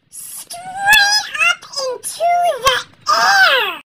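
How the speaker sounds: high wailing mock cry; hammed-up falling wail; troll crying out. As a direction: yelp as you fly through the air. Troll flung into the air.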